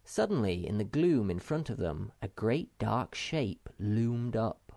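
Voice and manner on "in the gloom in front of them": ordinary delivery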